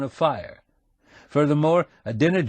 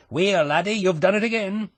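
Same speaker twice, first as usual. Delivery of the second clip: higher, hearty Scottish brogue; 9 semitones higher than usual. A narrator's reading. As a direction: roll out a shopkeeper's Scottish brogue. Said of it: "putting on the brogue".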